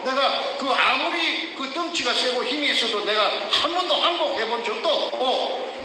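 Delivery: ordinary talk, nothing marked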